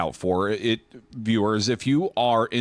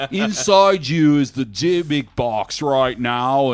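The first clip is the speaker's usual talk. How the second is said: slow, loud, higher TV ghost-hunter monologue; Zach Bagans; 4 semitones higher, 6 dB louder, slower than usual. Retold or imitated. Mimicking museum audio for co-hosts, overblown slow monologue. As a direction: announce it dramatically, drawn out and louder. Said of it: "it's like a monologue from Ghost Adventures".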